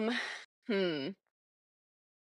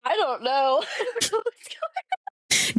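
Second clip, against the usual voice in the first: laughing; 8 semitones higher, 5 dB louder than usual. Real, not acted. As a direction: lose it to giggles mid-line. Giggling fit over chat chaos.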